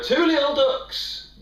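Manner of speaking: high, slow bingo call; bingo caller. Acted. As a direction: draw it out like a bingo hall caller. Bingo call for twenty-two.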